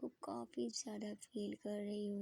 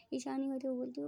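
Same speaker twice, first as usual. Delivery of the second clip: usual speaking voice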